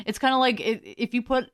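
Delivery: usual speaking voice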